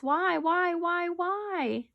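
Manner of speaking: high, drawn-out chain of whys; pleading wail. Acted. Animals begging the hidden stranger to answer, high wail.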